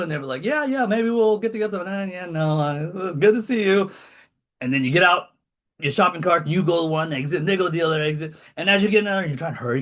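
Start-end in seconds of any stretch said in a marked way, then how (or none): 0.4-3.9 higher, lightly voiced forced politeness; mock small talk. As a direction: play the awkward acquaintance with a hint of strain. Awkward small talk retold.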